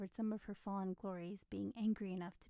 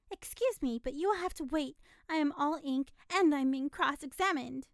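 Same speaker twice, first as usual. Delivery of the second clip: louder, higher flustered protest; rattled telegraph girl; exasperated outburst; 6 semitones higher, 9 dB louder than usual. A narrator's reading. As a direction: burst out at the interruption.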